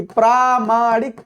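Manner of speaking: slow, careful प्रामाणिक with long प्रा; teacher spelling out प्रामाणिक for students; slow clear answer. Shown as a demonstration. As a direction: say प्रामाणिक slowly with a long aa at the start. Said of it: "प्रमाण में इक जोड़िए तो क्या बनेगा?".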